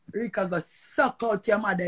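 normal talking voice

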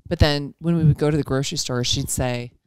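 normal talking voice

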